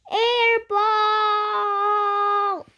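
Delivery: loud, high, drawn-out crowd chant; jeering crowd shout. Acted. Crowd taunts the player over a missed free throw, jeering shout. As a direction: holler it long and mocking, stretching the last word.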